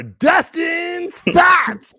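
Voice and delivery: slow, high, drawn-out show-title announcement; announcer voice. Acted. Host introducing his co-host's segment, hammed-up announcer. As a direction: stretch it out like a TV announcer.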